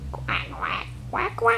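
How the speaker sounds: playful duck imitation; short, repeated duck quacks; duck quacking. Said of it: "what does the duck say".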